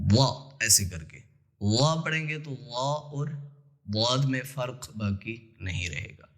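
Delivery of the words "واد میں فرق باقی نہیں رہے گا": usual speaking voice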